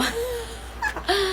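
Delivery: high, light laughter; real laugh at yourself; sheepish laugh. Real, not acted. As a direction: burst into a high giggle. Laughs about possibly expired product.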